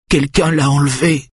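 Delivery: high, weak woman's voice; distressed. A narrator's reading. Wife tells husband of abduction, high distressed voice.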